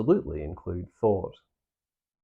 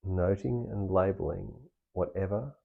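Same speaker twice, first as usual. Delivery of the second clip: softer, unhurried meditation guide with long pauses; guided meditation; 4 dB quieter than usual. Acted. Meditation teacher guiding listeners through practice, soft and calm. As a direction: ease the listener inward, gently.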